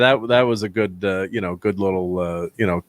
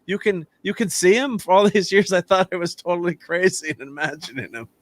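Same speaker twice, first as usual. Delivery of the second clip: lightly imitated dotty actor, higher pitched; a hint of Kingsley's bewildered Trevor, pitched up; mock-confessional Trevor voice, raised pitch; Trevor Slattery impression; 6 semitones higher than usual. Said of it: "Ben Kingsley's like".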